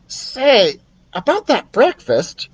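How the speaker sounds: high hinting mouse; high squeaky mouse. Acted. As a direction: mouse angling for food. Ralph voiced.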